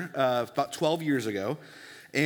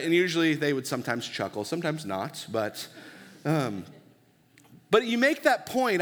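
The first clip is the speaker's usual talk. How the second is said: usual speaking voice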